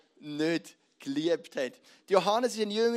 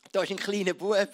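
normal talking voice

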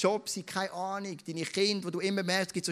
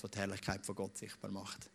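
normal talking voice

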